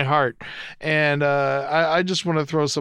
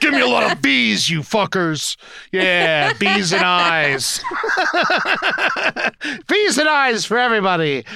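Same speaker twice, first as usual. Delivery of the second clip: mock rally cry; 4 semitones higher than usual. Mock generous rally cry, laughing.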